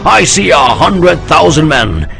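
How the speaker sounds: high loud oratory, slowing at the end; rousing speech. A narrator's reading. General rallying his army, loud rousing oratory. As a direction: stir the troops with every word.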